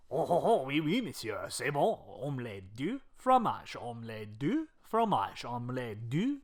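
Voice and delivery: hammed-up fake French accent; outrageous French accent. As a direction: put on a silly, exaggerated French accent. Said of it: "Put on an outrageous accent".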